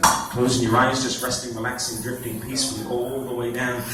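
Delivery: hypnotic induction. Acted. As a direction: drift them down gently. Deepening trance on stage.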